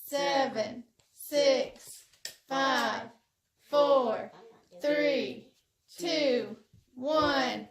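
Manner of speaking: loud shouted countdown; new year countdown crowd. Real, not acted.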